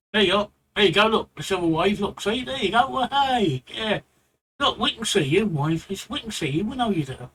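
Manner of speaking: ordinary talk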